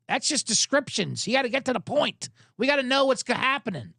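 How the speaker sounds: ordinary talk, nothing marked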